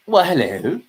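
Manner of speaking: a hammed-up welcome for a newcomer; put-on greeting. Greeting a stream viewer.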